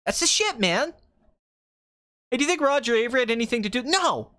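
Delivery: high, worked-up ranting director; hammed-up indignant rant; Quentin Tarantino. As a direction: rattle it off, then burst out indignantly. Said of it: "Okay, Quentin".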